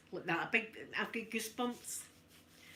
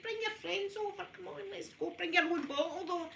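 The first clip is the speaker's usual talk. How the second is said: mocking party host; 9 semitones higher than usual.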